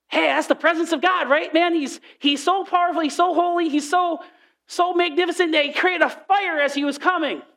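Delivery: high-pitched, gushing amazement; excited awe. Acted. Preacher acts out marvelling at God in the fire, excited. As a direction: gush like a thrilled onlooker.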